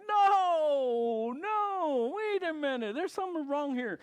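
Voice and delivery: high, loud cry of alarm; shocked disbelief. Retold or imitated. To the airline agent, a loud high alarmed protest. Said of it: "And I'm like".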